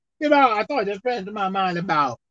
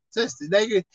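ordinary talk, nothing marked

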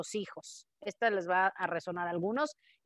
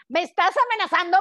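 mock outraged quote; 7 semitones higher, 7 dB louder than usual. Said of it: "me da risa que alguien te diga".

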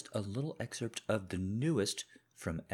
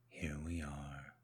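slow, whispered resignation; 6 semitones lower, 9 dB quieter, slower than usual. Acted. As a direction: let it fall into a whisper. Husband's quiet reproach.

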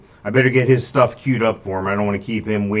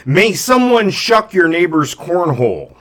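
high, loud mock seer curse; mystic curse; 7 semitones higher, 5 dB louder, slower than usual. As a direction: pronounce a silly curse grandly.

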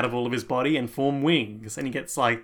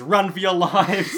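laughing shout, high and loud; 6 semitones higher, 8 dB louder than usual. Retold or imitated. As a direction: yell it through a laugh. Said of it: "just says".

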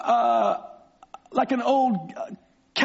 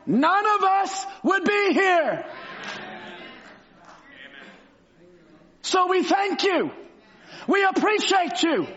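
preaching at full force; 5 semitones higher than usual. Acted. Preacher lifts the church with Branham's heaven vision, fervent shout. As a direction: hammer the hope home to the congregation.

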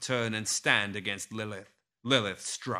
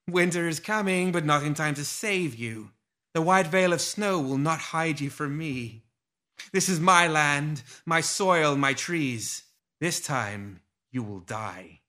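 high, taunting seductive menace; seductive villainess voice; 5 semitones higher than usual. A narrator's reading. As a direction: purr the threat from the dark. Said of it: "a seductive voice laughed softly".

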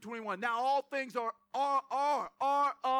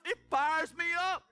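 fired up; 5 semitones higher, 4 dB louder than usual. Real, not acted. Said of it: "I start reading this stuff".

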